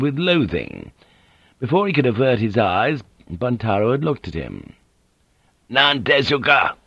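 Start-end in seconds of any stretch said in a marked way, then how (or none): 5.7-6.8 accusing. A narrator's reading. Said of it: "sounded almost like an accusation".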